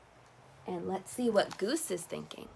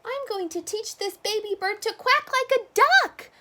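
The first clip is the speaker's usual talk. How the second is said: boasting; 13 semitones higher, 7 dB louder than usual. Acted. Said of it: "Duck boasted".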